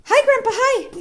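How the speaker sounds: high, loud greeting with a laugh; laughing high hello to grandpa; excited greeting. Real, not acted. Mom cheerfully coaxing toddler greeting.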